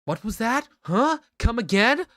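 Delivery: high, rising confrontational questions; mock challenge. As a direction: dare them to repeat it. Character challenges someone angrily.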